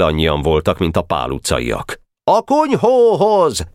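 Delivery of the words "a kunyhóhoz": high, drawn-out battle yell; howled command. A narrator's reading. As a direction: yell the order slowly and high. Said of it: "üvöltött Ács Feri". (magas, elnyújtott üvöltés)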